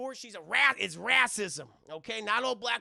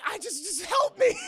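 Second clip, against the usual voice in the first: high shouted cry for help; hammed-up panic while dangling; mock desperate plea; 11 semitones higher, 9 dB louder than usual. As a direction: yell for rescue, comically strained. Voices Jackie hanging, pleading.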